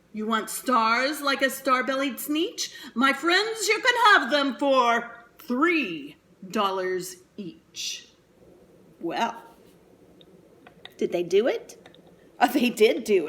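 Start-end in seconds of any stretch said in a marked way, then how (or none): 0.1-8.1 salesman voice. Acted. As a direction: tempt them with a sly deal. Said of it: "he said".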